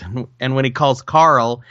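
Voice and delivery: normal talking voice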